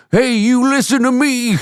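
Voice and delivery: very high, strained impression breaking into laughter; Robert De Niro. Comedian showing off his impression to his co-host, hammed-up and high. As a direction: do a hammed-up, high-pitched tough-guy impression. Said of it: "Have you heard my De Niro?".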